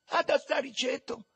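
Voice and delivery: high-pitched called question; shouted Roman street-boy question; calling out. Acted. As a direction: call it out to the gang, high. Boys call out across a field.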